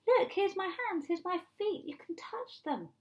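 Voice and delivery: high-pitched, coaxing acted offer; eager persuading. Jesus offers his body as proof, high eager persuading. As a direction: coax them in a lifted voice.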